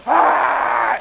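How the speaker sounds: sudden comic storybook shriek; mock scream. Storyteller acting the wolf's attack on Grandma, mock scream. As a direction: burst out with a hammed-up "ah".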